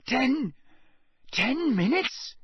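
high, slow stammered question; alarmed disbelief. A narrator's reading. Harry realising he has overslept, high and alarmed. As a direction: pitch it up as panic sinks in. Harry panicking over the time.

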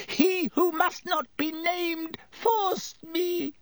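high, wailing tearful excuses; bursting into tears. A narrator's reading. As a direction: wail your excuses in a high voice. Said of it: "Pettigrew burst into tears".

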